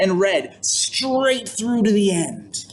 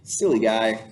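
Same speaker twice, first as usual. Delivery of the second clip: usual speaking voice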